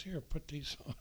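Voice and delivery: whispered instruction, barely voiced. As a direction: breathe it out like a secret. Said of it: "She goes".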